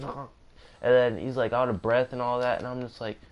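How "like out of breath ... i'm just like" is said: ordinary talk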